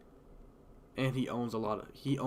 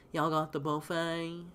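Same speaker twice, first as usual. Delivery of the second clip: mock caller voice; 6 semitones higher, 3 dB louder, slower than usual. Caller asking about buffet.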